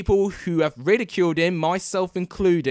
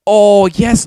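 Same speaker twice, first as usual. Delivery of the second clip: louder burst of delight; excited oh yes; 5 semitones higher, 11 dB louder than usual. Real, not acted. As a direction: cheer it out, louder. Replay shows keeper's hand.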